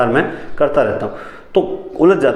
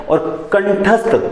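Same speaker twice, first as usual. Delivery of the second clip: कंठस्थ stretched out slowly, pitched higher; slow dictation; 6 semitones higher, much slower than usual. Shown as a demonstration. Teacher dictating a new word to the class, slow and high. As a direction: draw out कंठस्थ syllable by syllable as you write it.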